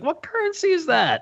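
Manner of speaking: very high, baffled query; incredulous question. Retold or imitated. Viewer reacting to a bag of gold coins, incredulous question. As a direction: pitch it way up in mock bafflement.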